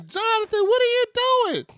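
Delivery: very high-pitched mock yell; put-on falsetto holler; imitated wife yelling. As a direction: screech like a mother catching her child. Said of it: "all I hear my wife yelling".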